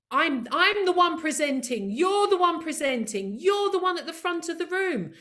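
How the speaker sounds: fired-up coach pep talk; emphatic rallying. Real, not acted. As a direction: hammer home who holds the room. Rallying the audience.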